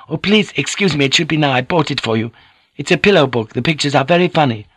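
put-on high, accented woman's voice; playful, pleading lady lover; eager Japanese lady. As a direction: coax your lover sweetly, pitched well up. Voices Mariko in the dialogue.

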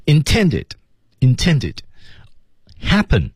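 "Intended" and "Happen" each stressed with a fall; stressed key words. Shown as a demonstration. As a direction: hit "Intended" and "Happen" separately, dropping each. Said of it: "这么几个关键词啊". (逐个重读"Intended"和"Happen"，语调下沉)

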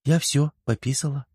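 put-on high-pitched child's voice; little girl making an announcement. A narrator's reading. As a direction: voice a small girl, pitched well up. Little girl character reports after toilet.

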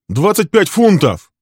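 slow, high, loud; young man answering the innkeeper, indignant; indignant shout. Acted.

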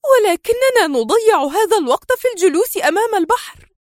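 Mona, exasperated, protests to Adham, high and tense; rising tension. A narrator's reading. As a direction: let her frustration burst out. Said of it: "تصاعدت حدة توترها".

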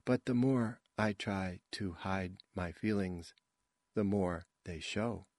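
soft, halting recital; wooden amateur actress. A narrator's reading. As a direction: recite quietly with gaps between phrases. Wooden actress's part in rehearsal.

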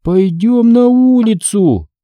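high, drawn-out undead whine; grumbling zombie son. A narrator's reading. Son grumbles from his corner, slow high zombie drawl. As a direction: stretch it out long and high. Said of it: "пробурчал из своего угла Натан".

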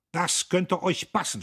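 loud, high indignant outburst; angry snort. A narrator's reading. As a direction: scold the idle workers angrily. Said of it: "schnaubte er". (wütend schnaubend)

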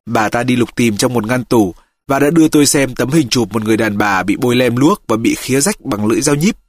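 usual speaking voice

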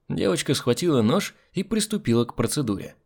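usual speaking voice

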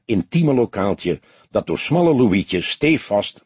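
usual speaking voice

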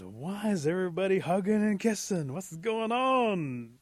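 high, loud bewildered questioning. Acted. Possum finds everybody hugging and kissing, bewildered. Baffled Possum walks into the celebration.

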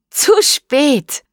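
high, sing-out taunt; teasing triumph. A narrator's reading. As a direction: call it out as she goes in. Boy throws girl into water. (hoch und neckend gerufen)

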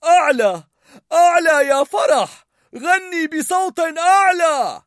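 high, loud shout, slowing on the last words. A narrator's reading. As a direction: yell them up the mountain. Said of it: "صاح بينغ بونغ".

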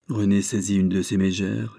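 usual speaking voice

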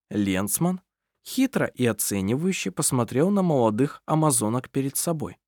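usual speaking voice

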